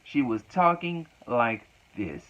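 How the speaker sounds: high, drawn-out mocking imitation; mock slow-motion talking. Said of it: "slow talking".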